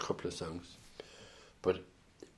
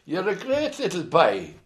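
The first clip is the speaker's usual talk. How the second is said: much higher, louder mock old-woman praise; 10 semitones higher, 7 dB louder than usual. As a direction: coo it like she's sweet-talking him to grab his pint. Imitating [name]'s praise.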